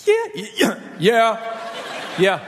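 high squeaky start, dropping down; comic voice-cracking stammer; mock nervous. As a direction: squeak out a nervous agreement, hammed-up. Nervous reply, acted for laughs.

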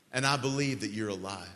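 phrase by phrase, long pauses between; slow led prayer. Real, not acted. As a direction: lead them in prayer slowly, leaving room to repeat each line. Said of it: "say this prayer slowly enough".

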